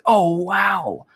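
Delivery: put-on high, fast, gushing girl voice; gushing student. Imitating an impressed student NPC, high and gushing. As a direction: play her wide-eyed and impressed. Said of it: "the girl's like".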